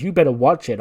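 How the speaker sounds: loud acted threat; mock warning. Johnny warning newcomer Garza, loud mock threat. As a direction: warn a rival off, hammed-up and loud.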